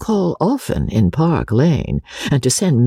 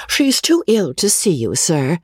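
lightly voiced young governess, pitched up; higher, polite message-bearer; Rebecca voiced; 7 semitones higher than usual. A narrator's reading. Rebecca relays message to baronet.

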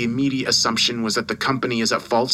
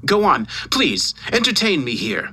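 emphatic scolding with leaping pitch; irate boss dressing someone down; angry tirade; 6 semitones higher than usual. Acted. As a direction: tear into a useless employee. Boss's furious tirade down the phone.

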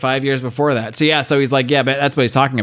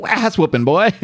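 a higher voiced gruff mentor; hammed-up coach quote, higher; Clyde's voice; 9 semitones higher than usual. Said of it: "He's like".

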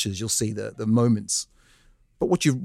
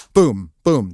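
punchy, louder booms; imitated gunshots; 5 dB louder than usual. Retelling being shot to the hosts, punchy gunshot booms. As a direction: hit each boom like a gunshot.

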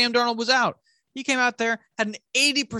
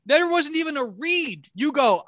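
ordinary talk, nothing marked

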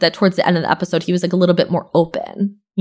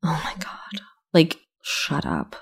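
whispered, much softer, slowed at the end; embarrassed whisper; 2 semitones lower, 10 dB quieter, slower than usual. Retold or imitated. A friend whispering shut up at her partner's dumb joke, embarrassed whisper. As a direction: hiss it under your breath to a cringey partner. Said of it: "you're just like".

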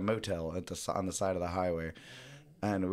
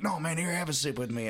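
higher, friendly pushy stranger; 7 semitones higher than usual. Retold or imitated. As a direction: coax him into a sip, pitched up. Retold guy offering vodka.

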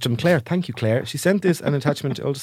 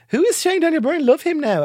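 1 mock fan; 14 semitones higher, 4 dB louder than usual. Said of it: "they keep tweeting about stuff like".

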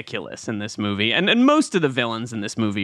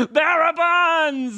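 drawn-out, high hammed-up cry; slow mock-villain relish; mock dramatic echo; 14 semitones higher, much slower than usual. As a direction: stretch it out very high, playfully overdone. Hammed-up echo of villain's loot phrase.

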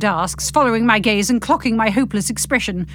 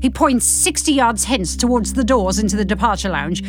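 usual speaking voice

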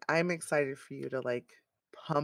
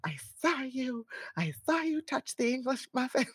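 higher, breathy mock accusation; half-laughing mock whisper; 9 semitones higher than usual. Retelling the muffin accusation, half-laughing mock whisper.

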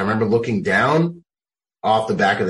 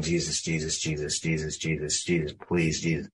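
quiet prayer; 4 semitones lower, 9 dB quieter than usual. Retold or imitated. Praying while dying.

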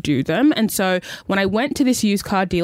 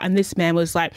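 ordinary talk, nothing marked

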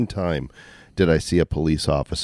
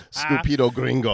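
mock cop; 7 semitones higher than usual. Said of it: "He's like".

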